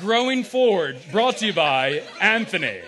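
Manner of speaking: loud, slow sponsor plug; hammed-up announcer voice; mock announcer. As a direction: boom it out like a radio ad. Jokingly credits Anthony as sponsor.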